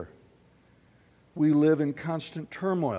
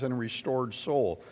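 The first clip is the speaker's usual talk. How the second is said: ordinary delivery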